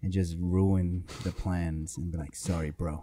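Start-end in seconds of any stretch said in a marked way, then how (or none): 2.3-3.0 deadpan apology. Acted. Mock apology to beaten opponent.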